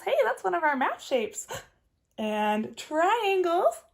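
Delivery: ordinary delivery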